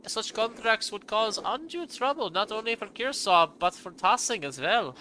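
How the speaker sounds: put-on high, slow German-accented lecture. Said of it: "I gave him a German accent".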